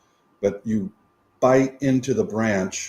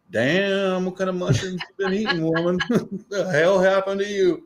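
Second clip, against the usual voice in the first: chicken voice; 9 semitones higher than usual. Pretend. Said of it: "They probably just be like".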